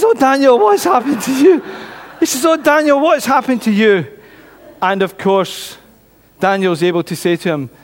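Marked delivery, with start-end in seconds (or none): 0.0-1.6 high, louder mock amazement; astonished king. King finds Daniel alive and smiling. 2.5-4.1 mildly amazed, starting high and falling; astonished king. Acted. Repeats the king's astonished question.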